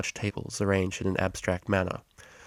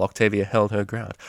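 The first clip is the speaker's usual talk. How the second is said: usual speaking voice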